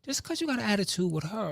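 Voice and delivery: high-pitched scolding, volume held back; retold yell. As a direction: tell them off in a high, exasperated voice. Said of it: "and I yelled at her".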